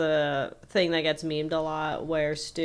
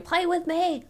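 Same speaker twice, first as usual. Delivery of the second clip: mock kid scream; 11 semitones higher than usual. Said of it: "She's screaming".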